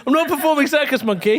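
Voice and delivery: high, fast, laughing refusal; laughing protest. Real, not acted. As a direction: object through laughter.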